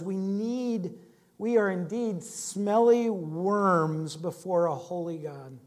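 normal talking voice

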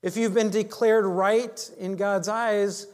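ordinary talk

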